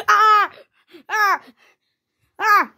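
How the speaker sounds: short, high, loud yelps; play-acted high screams; mock yells. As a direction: yelp with each blow. Struggle in the toy play.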